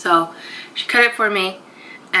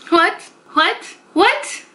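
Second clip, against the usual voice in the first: each what higher, climbing in disbelief; outraged disbelief, higher every time; stunned what; 11 semitones higher, much slower than usual. Real, not acted. Reacting to rude comment.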